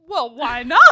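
loud mock protest; punchy, played-up retort; indignant comeback. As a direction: imitate a husband objecting loudly. Acting husband's indignant comeback.

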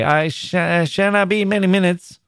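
a shy man stumbling as he moves away; stammering miner. A narrator's reading. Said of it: "he stammered".

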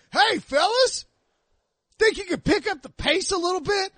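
high-pitched imitation of a heckling golfer; put-on high holler from behind; imitated golfer calling out. Retelling a golfer calling out to them.

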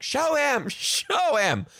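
high, stretched, emphatic urging; insistent kid urging. Retold or imitated. Samuel urging another kid to guide the teacher, insistent high call. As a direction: imitate an eager child insisting.

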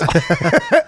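real laugh at the joke; high burst of laughter. Real, not acted. Friends joking about Stephen Hawking, laughing.

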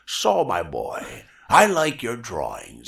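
put-on low, slow, rich old gentleman; old Disney man's voice. Imitating the old Disney animator praising young Saul. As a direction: savor each word like a grand old mentor. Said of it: "He had the coolest voice".

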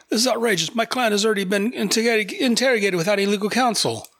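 high-pitched indignant protest; outraged lawyer. Retold or imitated. Lawyer protesting to the guard about his client's interrogation, high indignant outrage. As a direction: sputter like a lawyer scandalized on his client's behalf. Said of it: "His guy's like".

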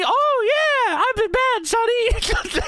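very high cartoon puppet, hamming it up; high-pitched Elmo. Elmo gyrating at Sonny, high cartoon voice. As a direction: squeal it as a naughty Elmo. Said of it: "there's only so much high pitch I can do here".

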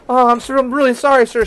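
high, flustered mock apology; imitated panic. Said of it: "in my moment of panic".

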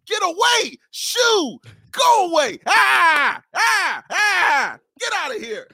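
high shoo-shoo, shooing a pest away; shooing an animal. Pretend. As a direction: chase off a stray.

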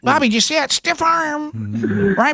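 high excited broadcaster voice; announcer imitation. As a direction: mimic the ballistic TV announcer. Host mimics announcer Beth Moen.

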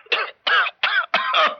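big staged smoker's cough; hacking cough. Acted. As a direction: cough up the drag. Teacher demonstrating inhaling.

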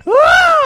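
high, loud yelping scream; imitated scream. Host recreating the infamous campaign scream, loud yelp. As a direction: let out a wild rally yelp. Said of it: "And then he goes".

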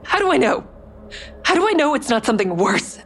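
terrified woman questioning her stalker; panicked pleading. Acted. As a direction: fear spilling out fast. Ava panics over pill.

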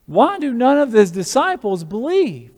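high, acted self-doubting questions; distraught Mary Magdalene. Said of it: "She's probably thinking".

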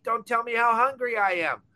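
normal talking voice

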